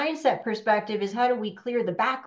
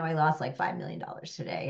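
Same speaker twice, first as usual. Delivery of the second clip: casual; 5 semitones lower, 5 dB quieter than usual. Retold or imitated. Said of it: "he very casually was like".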